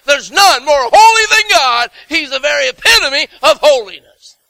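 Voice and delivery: high, loud preaching building to a shouted cry; full-force preaching. Real, not acted. Preacher declaring the seraphim's loud cry, full force.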